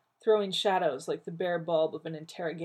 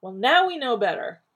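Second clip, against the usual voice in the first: peevish; 6 semitones higher, 10 dB louder than usual. Acted. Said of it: "Rodney said peevishly".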